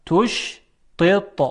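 تشطط very slowly in separate beats, both ط heavy; segmented demo of تشطط; double emphatic ta. Shown as a demonstration. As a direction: sound out تشطط, holding the ش before thick ط. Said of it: "دو تا حرف تا هست".

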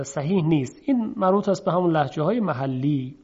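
ordinary talk, nothing marked

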